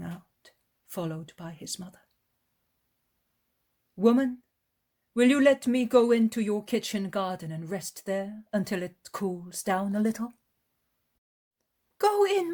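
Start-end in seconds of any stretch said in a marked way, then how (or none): 4.0-10.3 gentle master asking a favour, higher and louder; a kindly teacher asking politely; voiced Jesus, raised and louder. Jesus speaks to the woman. 12.0-12.5 much higher, louder woman's voice; an eager hostess welcoming a guest; eager village woman. Acted.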